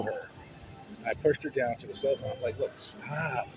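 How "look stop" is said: high, strained plea without shouting; retold plea, high and drawn out; exasperated pleading. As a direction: beg them to stop, pitch climbing. Pleading during a fight.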